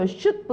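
"should" as one syllable plus a faint "브"; reduced "should have". Shown as a demonstration. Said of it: "이렇게 발음됩니다".

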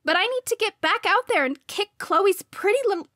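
high, loud rush of indignation; fired-up anger. A narrator's reading. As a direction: burst out, itching to get back into the fight. Hurt girl eager to fight back.

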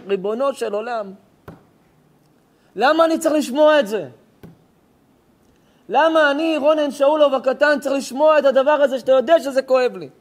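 desperate loud lament, voice straining; anguished cry to God. Real, not acted. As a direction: cry out to heaven in pain. Crying out to God.

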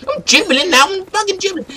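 very high, frantic gamer fury; worked-up rage. Acted. Player told it's only a game, high-pitched rage. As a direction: refuse to calm down, voice way up high. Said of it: "calm the fuck down".

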